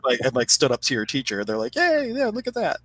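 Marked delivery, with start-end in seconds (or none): 1.8-2.8 high mock cheering yay. Teacher cheering the student out of the cave, high mock cheer. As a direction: squeal a teasing yay.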